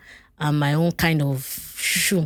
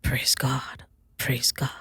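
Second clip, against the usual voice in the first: softer, muttered "Praise God" under his breath; overheard murmur; 4 semitones lower, 6 dB quieter, much slower than usual. Retold or imitated. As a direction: mutter it as if to yourself. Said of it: "I just heard Pastor Lan saying".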